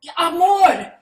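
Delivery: loud, high, gleeful shout; excited shout. Acted. Partner echoes the new Spanish word, shouting gleefully. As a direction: cheer the word like a victory. Improv scene, joining the excitement.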